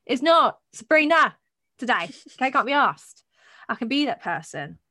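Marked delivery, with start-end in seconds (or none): 0.0-3.2 louder, firm refusal; defiant refusal. Real, not acted. Telling friends off the persona, loudly defiant. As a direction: plant your feet and refuse.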